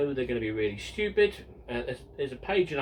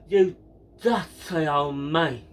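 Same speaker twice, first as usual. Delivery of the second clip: slow, louder put-on Steptoe whine; Harold Steptoe impression; 6 dB louder, slower than usual. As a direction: sneer at the old man like Harold Steptoe. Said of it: "Harold Steptoe goes to his father".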